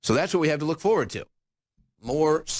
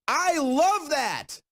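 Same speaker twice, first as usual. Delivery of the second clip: sarcastic; 11 semitones higher, much slower than usual. Real, not acted. Sarcastic remark.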